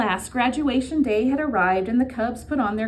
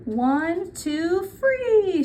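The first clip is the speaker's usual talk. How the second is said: put-on higher and higher, childlike counting; tiny child calling out the count; little cub counting; 8 semitones higher, much slower than usual. A narrator's reading. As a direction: toddler proudly counting to three. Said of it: "she called".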